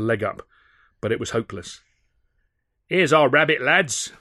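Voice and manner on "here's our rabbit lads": loud, high gloating shout. Acted. Thug cornering his prey, shouting to his mates. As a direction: call out to the lads, gloating. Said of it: "came a shout".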